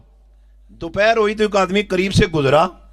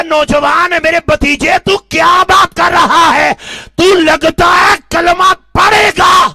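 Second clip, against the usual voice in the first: furious uncle; 7 semitones higher, 6 dB louder than usual. Acted. Uncle shouting at his nephew, loud and furious. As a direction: shout it down at him. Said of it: "چچا بات کو پھر سے کہنے لگا".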